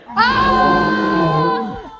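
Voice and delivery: loud shout at the balloon; yell. Acted. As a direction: shout it out hard. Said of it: "yell at our balloon".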